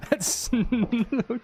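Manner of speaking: high laughing gasp. Real, not acted. Laughs at own gaffe.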